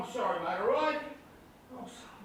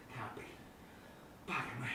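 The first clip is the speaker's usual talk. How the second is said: stunned hush; 13 semitones lower, 21 dB quieter than usual. Acted. Stunned at seeing Greg Chappell.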